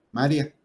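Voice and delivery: "María" said as MÁ-ria, two syllables; stress shifted to first syllable. Shown as a demonstration. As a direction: stress the first A and glide the "ia" together. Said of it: "no lo viéramos con el acento".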